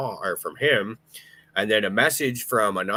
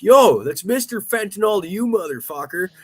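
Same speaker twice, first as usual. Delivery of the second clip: high, louder joking comeback; mock indignant shout; 11 semitones higher, 8 dB louder than usual. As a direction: fire back with fake pride. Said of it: "And I'm like".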